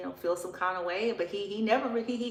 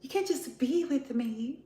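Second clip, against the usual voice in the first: mock pleading; 7 semitones higher than usual. Mock of ghosted woman pleading.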